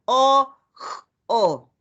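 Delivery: "O, o" each sound said alone; phonetic demo. Shown as a demonstration. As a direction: sound out "O" and "o" separately. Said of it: "Aquí el fonético". (sonidos "O, o" aislados)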